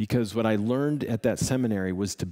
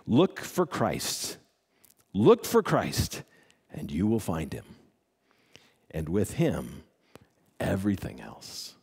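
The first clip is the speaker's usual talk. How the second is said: slow solemn quote; 4 semitones lower than usual. Acted. Closing quote read slowly.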